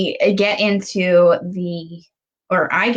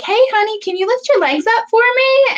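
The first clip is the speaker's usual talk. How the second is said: much higher, sing-song nurse voice; cheery nurse; 4 dB louder than usual. Retold or imitated. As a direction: chirp it up high like a cheerful nurse. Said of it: "She's like".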